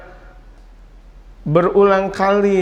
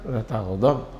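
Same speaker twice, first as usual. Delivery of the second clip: quiet curt reply; 7 semitones lower, 8 dB quieter, slower than usual. Retold or imitated.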